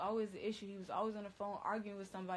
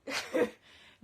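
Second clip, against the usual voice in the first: loud breathy laugh; 11 dB louder than usual. Real, not acted. Laugh burst.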